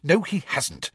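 high, curt contradiction; snapped. A narrator's reading. Schoolgirl contradicting a classmate, snapped. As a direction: snap back in disagreement. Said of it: "snapped Hermione".

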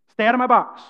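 loud, firm command; bold demo. Shown as a demonstration. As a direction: say it boldly, projecting. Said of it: "He was showing the difference that when you say".

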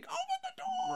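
very high, muted falsetto yell; distant-sounding strained plea, kept soft; mock desperate cry. Retelling family slapping the locked door, mock desperate cry.

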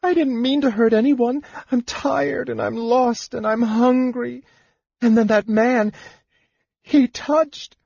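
tears turning real as she speaks; tearful plea. Acted. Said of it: "The tears came for real".

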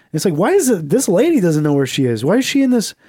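usual speaking voice

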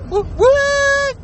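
high-pitched double whoop; drawn-out celebratory woo; excited whoop. Real, not acted. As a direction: whoop high, second one long and held. Cheering after his salute.

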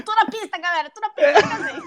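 mock boast while laughing; laughing mock quote.

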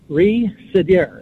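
"Re-sidier" slowly, RE held apart from sidier; syllable split. Shown as a demonstration. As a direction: break "Re-sidier" after a drawn-out first syllable. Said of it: "it's all about the syllables".

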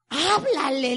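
loud, firm "Háblale"; retold loud urging; insistent order. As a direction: push him to make the call. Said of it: "le decía yo".

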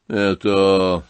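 громко и выше, резко; резко вскрикивает на князя; резко вскричал. Acted. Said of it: "резко вскричал генерал". (генерал резко и громко спрашивает князя)

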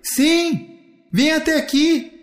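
very high, sustained answering call; high-pitched playful holler; calling back. Acted. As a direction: answer from afar and beckon. Brother calls back from afar.

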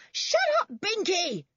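very high, sharp shout. A narrator's reading. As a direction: shout it high at a barking dog. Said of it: "shouted Toby".

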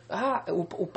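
ordinary delivery

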